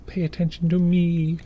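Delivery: high-pitched, begging puppy voice; pleading puppy. Acted. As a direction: beg for attention like a needy pup. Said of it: "like they're pleading with you".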